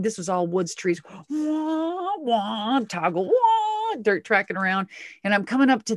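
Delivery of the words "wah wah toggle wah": high, drawn-out "wah" revving; imitated engine revs. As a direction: sound out the truck bouncing round. Imitating the truck on track.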